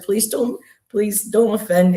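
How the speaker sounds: pleading. Retold or imitated. Retelling her plea to goddess.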